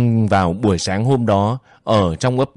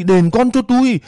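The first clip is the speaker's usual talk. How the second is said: wailing demand; 11 semitones higher than usual. Acted.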